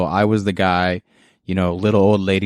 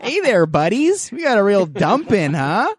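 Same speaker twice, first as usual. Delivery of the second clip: cheery fake neighbor voice, laughing; customer service voice; 12 semitones higher than usual.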